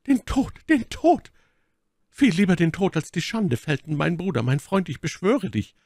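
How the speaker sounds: high, desperate plea for death; beschwörendes Flehen. Acted. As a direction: cry it out high, imploring a friend. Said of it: "und die Augen riefen". (hohes, verzweifeltes Flehen)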